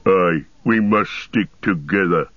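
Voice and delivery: orc chief's heavy growl; Ugluk. A narrator's reading. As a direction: growl a grudging agreement. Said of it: "growled Ugluk".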